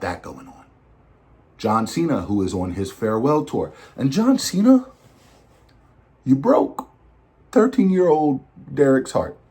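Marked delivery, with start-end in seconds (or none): 4.0-9.4 higher-pitched, playfully aggrieved address; mock reproach. Fan telling John Cena he broke his heart, mock reproach. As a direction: call him out like a let-down fan.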